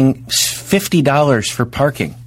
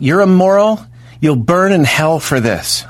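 mock yell; 5 semitones higher, slower than usual. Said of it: "I rolled down the window and go".

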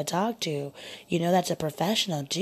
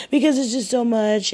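high, loud whiny wail; overwhelmed, in an imitated sobbing voice; mock crying moan; 6 semitones higher, 7 dB louder than usual. Woman mimicking her date's complaints, mock tearful moan. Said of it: "he was crying and he was moaning".

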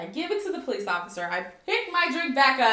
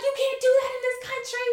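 high-pitched imitation of a yell; 12 semitones higher than usual. Boyfriend yells back at her beside police car, alarmed yelling. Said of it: "we're yelling at each other".

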